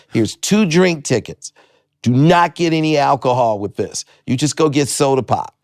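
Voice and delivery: imitated club owner. Club owner handing teen drink tickets, strict warning. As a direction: warn the kid firmly, stressing each word.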